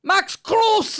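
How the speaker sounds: very high, loud screamed name; commentator shout. Retold or imitated. Mimicking the BT Sport guy, a hammed-up shouted call. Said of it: "he'd be like".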